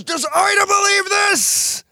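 very high, slow, incredulous dad voice; imitating dad. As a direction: stretch it out high in disbelief. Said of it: "And then he goes".

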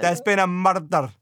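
badly faked Scottish detective; hammed-up Scottish accent. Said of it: "that was a particularly bad accent".

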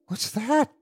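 high-pitched startled question; startled cry. A narrator's reading. Old woman starts up at a knock, startled cry. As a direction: cry out as if jolted upright. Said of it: "cried the old woman, starting up".

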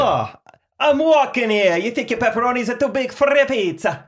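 high, hammed-up Italian accent; Italian-New York accent. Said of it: "Kinda like a true Italian".

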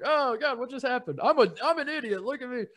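high, flustered mock panic; mock flustered self. Retelling the fake spill, high mock fluster. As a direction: play the clumsy idiot who spilled it.

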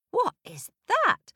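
high, sly trickster voice; Coyote voice. Acted. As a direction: ask it like a curious coyote. Coyote asks in the dark.

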